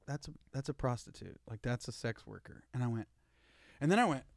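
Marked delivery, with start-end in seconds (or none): 0.1-2.7 imitating a quiet, low, deadpan aside; matter-of-fact. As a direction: state it softly as plain fact, voice dropped. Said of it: "so matter-of-factly, she's like".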